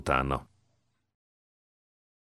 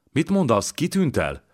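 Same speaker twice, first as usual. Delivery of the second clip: higher, startled question; alarmed disbelief; 9 semitones higher than usual. A narrator's reading. Jankó questioning the student about the news, alarmed disbelief. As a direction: ask in sudden alarm, pitch jumping up. Jankó hears Erzsébet is missing.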